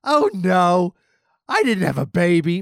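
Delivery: high, loud goofy cartoon cat; Stimpy telling Ren he's having a baby, high and loud. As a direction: squeal it like a dopey cartoon cat. Stimpy voiced after fart.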